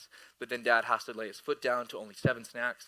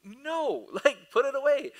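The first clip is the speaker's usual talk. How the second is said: high-pitched comic no, then louder order; exaggerated refusal; 12 semitones higher, 4 dB louder than usual. Dad refusing son's puppy eyes, hammed-up firmness.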